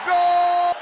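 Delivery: long high goal scream; goal shout. Real, not acted. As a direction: belt out the goal call. Goal call, sustained high shout.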